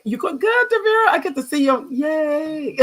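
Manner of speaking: high, gleeful cheer; squealing with delight into a yay; real excitement. Real, not acted. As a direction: cheer for a friend, voice soaring. Cheering that she will see friend.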